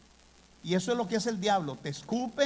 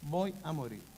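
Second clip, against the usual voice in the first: "Voy a morir" repeated much softer, fading; fearful hush; 2 semitones lower, 6 dB quieter than usual. Retold or imitated. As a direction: let the second "Voy a morir" sink to a frightened murmur. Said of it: "que Él ahora teme".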